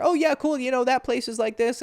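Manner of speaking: high, rushed eager chatter; overeager chatter. Retold or imitated. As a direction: gush on without listening. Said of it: "He's like".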